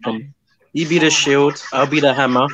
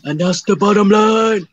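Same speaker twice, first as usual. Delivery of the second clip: higher, slower wrestler catchphrase; Stone Cold Steve Austin; 7 semitones higher, slower than usual. Acted. Streamer doing a wrestler's famous catchphrase, Stone Cold impression. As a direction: draw out a big wrestling sign-off. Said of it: "stone cold Steve Austin".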